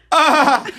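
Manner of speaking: high mock scream of fright; high-pitched retold scream; startled scream. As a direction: yelp as if a giant bird appears. Retold fright as hawk appears.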